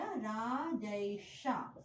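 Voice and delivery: राजैषा stretched very slowly; slow teacher demo of राजैषा; drawn-out answer. Shown as a demonstration. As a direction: say राजैषा slowly so students hear each sound. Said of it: "तो शब्द क्या बन जाएगा?".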